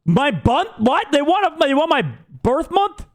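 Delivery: high disbelieving rise; baffled, voice shooting up; incredulous. Real, not acted. Surprised by form question.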